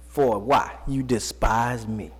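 low, slow reproach from God; voicing God. Preacher voicing God's reproach.

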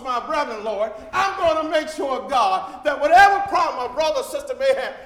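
rising, loud preaching prayer; heartfelt prayer at full preaching force; fervent prayer. Acted. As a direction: pour it out to the Lord with fire. Preacher voices a prayer to God.

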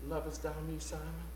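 soft, low, tender invitation; gentle forgiving appeal. Acted. Jesus asking fallen Peter to come, gentle and patient. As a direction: invite him back quietly, pausing between phrases.

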